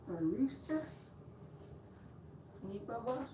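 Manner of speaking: soft high pet talk. Real, not acted. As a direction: coo softly at the pet. Talking to the pet.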